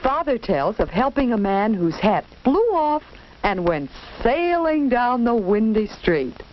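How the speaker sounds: ordinary delivery, nothing marked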